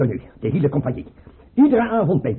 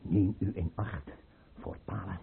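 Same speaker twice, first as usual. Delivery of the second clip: hushed, soft warning in the ear; whispered; 8 semitones lower, 12 dB quieter than usual. Acted. Tsar warns his chamberlain secretly, hushed whisper. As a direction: lean close and breathe the warning. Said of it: "ik zal het in je oor fluisteren".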